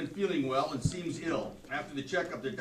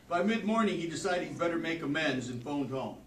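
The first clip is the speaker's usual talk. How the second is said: ordinary delivery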